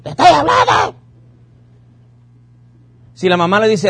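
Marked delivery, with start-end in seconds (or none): 0.0-1.0 high, loud mock yell at a child; shrill-free shouted scolding, much higher and louder; imitated yelling mother. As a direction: bark it like an exasperated mom. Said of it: "le gritan a los chamacos".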